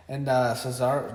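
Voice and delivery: usual speaking voice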